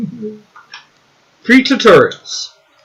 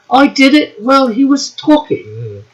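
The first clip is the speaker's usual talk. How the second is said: reading chat in a put-on voice, higher; hammed-up posh reading voice; a playful mock accent; 4 semitones higher than usual. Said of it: "she didn't say it like that".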